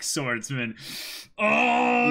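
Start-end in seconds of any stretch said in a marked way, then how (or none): 1.4-2.1 high loud thrilled cry; excited cry. Real, not acted. Reacting to the chapter title, a loud excited cry. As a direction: let out a cry of hype.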